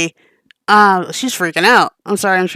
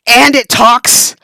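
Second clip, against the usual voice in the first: slow, loud amazed exclamation; awed surprise; 4 semitones higher, 8 dB louder, much slower than usual. Acted. To the behemoth, loud slow amazement. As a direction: marvel at the talking beast.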